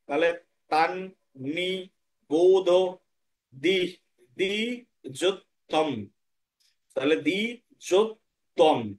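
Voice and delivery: তান নি বোধ দি যোত্তম said slowly, each syllable apart; split syllables. Shown as a demonstration. Teacher splits the Sanskrit words apart for students. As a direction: break the word into its parts slowly. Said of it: "একটা দন্তান্নকে আলাদা করে নিন".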